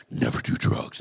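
hammed-up deep, rumbling father; deep dad voice. Big dad lecturing his young son, deep voice. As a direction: put on your daddy's deep voice. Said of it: "My daddy had a deep voice".

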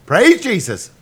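high, loud preacher exclamation. Pretend. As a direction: belt it like a revival preacher. Mock church call and response.

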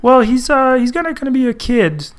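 high, hesitant caricature voice; George Lucas. Retold or imitated. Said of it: "Why does George Lucas always sound like that".

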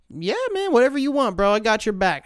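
high, loud, over-supportive reply; mock eager agreement. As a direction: jump in loudly with exaggerated support. Retold over-eager agreement to odd request.

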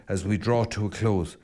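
normal talking voice

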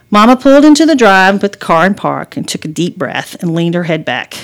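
usual speaking voice

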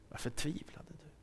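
breathless whisper; whispered echo. Acted. Preacher repeats Jesus' words to the congregation, whispered. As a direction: whisper the question back to them.

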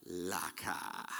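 "laka" said low and soft, LAH-kah; Hebrew word laka. Shown as a demonstration. Preacher teaching the Hebrew word, low and soft. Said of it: "The word is".

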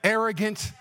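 usual speaking voice

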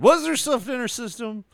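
high, loud incredulous question; mock interrogation. Questioner pressing about what was in her system, high and loud.

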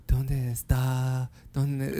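slow, low, halting Spanish; groping for words. Retold or imitated. Customer trying to recall Spanish, slow and halting. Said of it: "I was trying to think".

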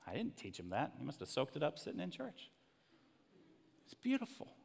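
ordinary delivery

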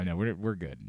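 ordinary talk, nothing marked

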